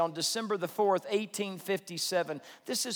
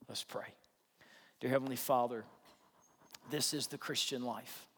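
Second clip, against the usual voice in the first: closing prayer; 4 semitones lower than usual. Acted. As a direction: pray gently and sincerely for the whole room.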